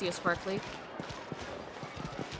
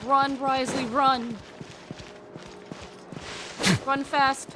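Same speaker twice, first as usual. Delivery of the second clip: playful urging; 7 semitones higher, 3 dB louder, slower than usual. Pretend. Player cheers Wriothesley on in a game, playful urgent call. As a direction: call out higher and louder.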